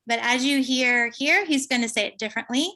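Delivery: ordinary talk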